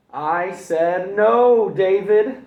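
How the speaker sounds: very slow, very loud, high; furious mother after the crash; mom shouting. Acted. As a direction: hammer every word. Mom scolding after breakage.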